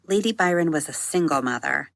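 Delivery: usual speaking voice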